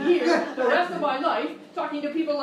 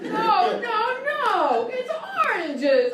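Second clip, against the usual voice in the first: higher, louder frustrated outburst; exasperated host; 8 semitones higher, 6 dB louder, much slower than usual. Acted. Game show host to dumb contestants, exasperated outburst. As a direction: blow up at the idiots.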